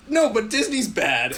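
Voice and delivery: high, loud, slowed-down mimicry; mocking imitation. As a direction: parody a stubborn arguer's comeback. Mimics co-host's stubborn comeback.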